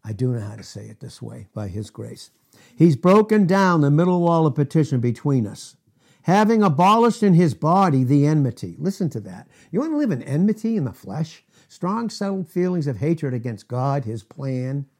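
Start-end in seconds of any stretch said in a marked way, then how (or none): 2.8-8.8 loud, driving preacher cadence; forceful preaching. Real, not acted. Preacher driving the point home to his congregation, loud and forceful.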